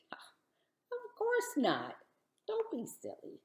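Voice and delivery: slow, high whisper; whispered doubt. Acted. Sarah's inner doubt voiced.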